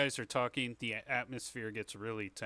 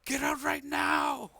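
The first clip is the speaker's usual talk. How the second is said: high, full-force furious shout; yelling; 7 dB louder, slower than usual. Acted. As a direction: yell it high and loud, stretching each word. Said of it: "And she yells".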